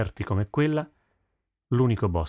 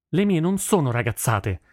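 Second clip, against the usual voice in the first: high-pitched, piqued retort from a daughter; 7 semitones higher than usual. A narrator's reading. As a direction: answer back, stung. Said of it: "ribatté Nihal piccata".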